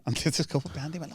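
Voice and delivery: ordinary talk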